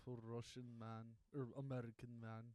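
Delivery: put-on heavy Russian accent, deadpan; a Russian woman's flat introduction; thick Russian accent. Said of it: "Take the accent out of it".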